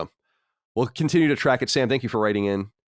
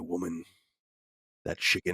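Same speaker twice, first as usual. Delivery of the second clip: fake Southern man; 4 semitones lower, 8 dB quieter than usual. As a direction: put on a fake Southern husband voice, low and quiet. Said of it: "my fake Southern man".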